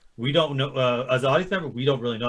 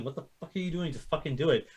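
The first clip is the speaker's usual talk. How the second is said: mouthed urging; 8 dB quieter than usual. Retold or imitated. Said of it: "you know, mouthing".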